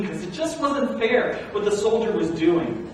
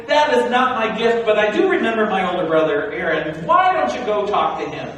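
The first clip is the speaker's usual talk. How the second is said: loud, higher, pleading objections; frantic protest; 3 semitones higher, 7 dB louder than usual. Acted. Moses begging the voice to pick someone else, loud and frantic. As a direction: argue your way out of it.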